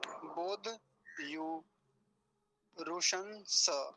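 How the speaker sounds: बोध, यु, रुशन said apart, slowly; syllable by syllable. Shown as a demonstration. Teacher splits the word into syllables for students. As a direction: separate each piece with pauses. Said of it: "इसको इसके साथ लेंगे ना".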